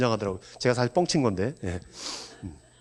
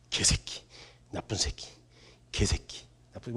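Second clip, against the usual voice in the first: whispered swearing under the breath; muttered curses; 5 semitones lower, 10 dB quieter, slower than usual. Retold or imitated. Tearful mother cursing to herself, whispering. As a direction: hiss the curses to yourself.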